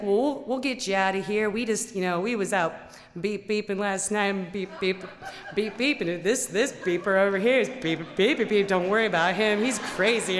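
loud bleeped rambling; a hint of a rowdy country guy; rowdy trucker, loud and laughing. The trucker explaining his night out, loud and rough. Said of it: "he's like".

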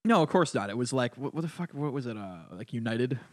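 ordinary talk

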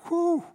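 high, loud exclaimed whew; sharp whew of amazement; excited whew. Real, not acted. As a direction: let out a high whoop of relief. Preacher exclaims over the garden.

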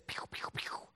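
voiceless toy-pistol pews; playful shootout sound effects; imitated gunshots. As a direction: fire off mouth-made gun noises.

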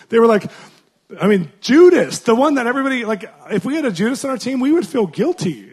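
animated burst about Judas; excited. Real, not acted. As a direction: build the point with rising heat.

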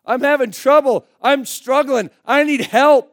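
loud, high plea for rescue; impassioned cry for help. Acted. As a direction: shout it out as a desperate confession. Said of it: "No, he cried out".

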